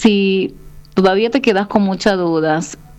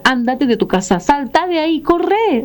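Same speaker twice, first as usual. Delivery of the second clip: urgent warning; 7 semitones higher than usual. Retold or imitated. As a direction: pitch climbing higher with each urgent command. Warning the woman to flee. (advertencia urgente, cada vez más aguda)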